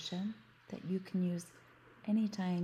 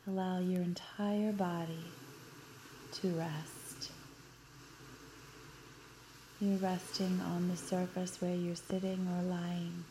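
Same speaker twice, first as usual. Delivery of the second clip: guided meditation. Acted. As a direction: guide them gently into relaxation. Leads a guided beach visualization.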